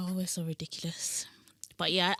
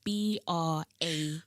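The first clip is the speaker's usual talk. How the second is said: slow, separated letters; measured letter-by-letter spelling; much slower than usual. Shown as a demonstration. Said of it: "So my name is spelled".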